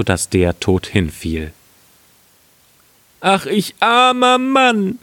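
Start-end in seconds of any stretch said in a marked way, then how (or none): 3.2-5.0 high, drawn-out mock wail; wailing lament. Carter finding his wine lost, wailing in high voice. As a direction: bemoan your bad luck, stretched out high. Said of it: "rief er".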